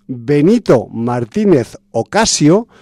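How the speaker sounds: measured, deliberate reveal of a full name; slow, careful enunciation. Shown as a demonstration. Podcast host unveils an artist's civil name, slowly and carefully. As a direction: spell it out slowly, every syllable distinct. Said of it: "lo voy a decir despacito y bien, con todas las letras".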